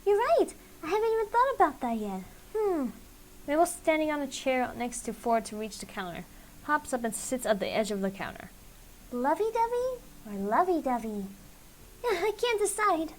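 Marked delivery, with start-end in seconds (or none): 0.0-2.9 put-on much higher, sing-song matchmaker girl; bubbly Mabel voice. Mabel teasing Ford and Sylvia while baking, high and gushing. 9.1-13.1 put-on much higher, sing-song matchmaker girl; bubbly Mabel voice. Mabel teasing Ford and Sylvia while baking, high and gushing.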